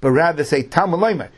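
ordinary delivery

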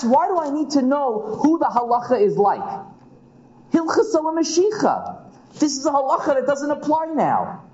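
high, exasperated challenge; Rava's indignant question. Acted. Teacher voicing Rava's challenge, high and exasperated. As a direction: raise the pitch and push back on the text. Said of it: "Rava asks".

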